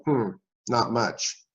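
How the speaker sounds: slow, low mumble to himself; mumbling. Retold or imitated. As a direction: mumble it, barely giving it voice.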